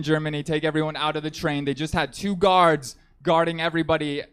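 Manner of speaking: ordinary talk, nothing marked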